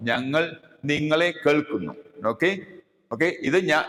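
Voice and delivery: normal talking voice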